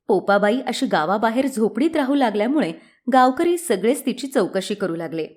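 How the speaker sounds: ordinary delivery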